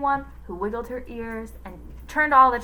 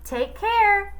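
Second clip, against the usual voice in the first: put-on high kid voice; boy's voice; 12 semitones higher, 7 dB louder than usual. A narrator's reading. Villain faking a child's goodbye, high. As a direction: chirp it like a child. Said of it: "said Calvin's voice".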